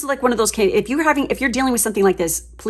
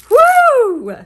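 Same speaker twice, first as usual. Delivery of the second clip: high, loud ecstatic woo; excited whoop; 9 dB louder than usual. Real, not acted. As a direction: whoop with pure delight. Card reading, ecstatic reaction.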